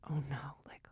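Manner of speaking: whispered worry; hushed panic. Retold or imitated. Reacting to a midnight text from a friend, hushed panic. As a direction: breathe it out, alarmed.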